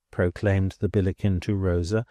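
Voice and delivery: plain reading voice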